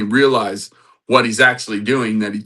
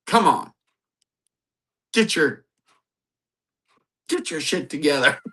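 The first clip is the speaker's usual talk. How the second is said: high, halting fed-up scolding; mock exasperated; 6 semitones higher than usual. Jesus jokingly tells Peter off, mock exasperated. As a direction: play at losing patience, with pauses.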